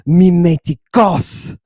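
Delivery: MIMETIKOS slowly, punching the final KOS; drawn-out MIMETIKOS, accent landing hard on the last syllable; hit the accent. Shown as a demonstration. Teacher showing the class the Greek accent, stressed final syllable. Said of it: "You hear that time I hit the accent".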